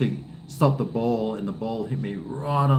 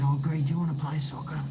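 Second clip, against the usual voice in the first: put-on higher, fast eager kid voice; kid neighbour; 4 semitones higher, faster than usual. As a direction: mimic the little boy begging to play. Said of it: "he goes".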